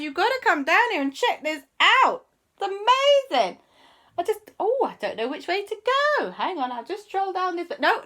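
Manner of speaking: very high, loud, breathless excitement; squealing over the tunnels; thrilled discovery. Acted. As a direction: gush about the amazing tunnels.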